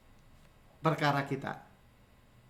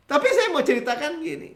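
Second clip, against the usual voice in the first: normal talking voice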